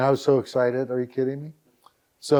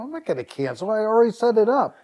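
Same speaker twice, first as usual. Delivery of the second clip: high, rising protest, quickening and louder at the end; indignant refusal; 8 semitones higher, 4 dB louder, faster than usual. Retold or imitated. Website owner refusing Good Morning America's demand to cancel, indignant. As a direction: push back in a high, indignant voice.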